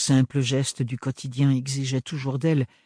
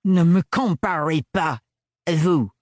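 indignant retort; 4 semitones higher, 4 dB louder, slower than usual. Acted. Octavio rebuffs the sans-peur.

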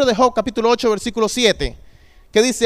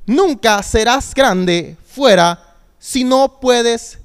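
ordinary delivery

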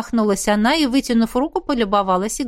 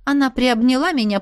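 ordinary delivery, nothing marked

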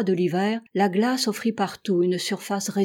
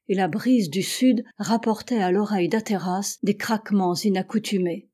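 ordinary delivery

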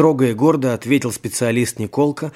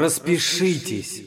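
mildly spiteful; 4 semitones higher, 5 dB quieter, slower than usual. A narrator's reading. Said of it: "злобно сказал голос за дверью".

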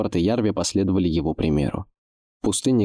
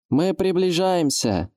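slow high call over the wind; shouting over wind; 11 semitones higher, slower than usual. Acted. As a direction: yell it against a gale. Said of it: "пытался перекричать поднявшийся ветер".

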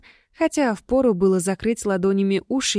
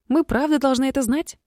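indignant; 6 semitones higher than usual. Acted. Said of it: "возмутилась Генет".

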